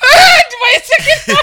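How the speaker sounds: squealing with laughter; laughing. Real, not acted. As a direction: laugh out the words high and loud. Laughing hard at the face.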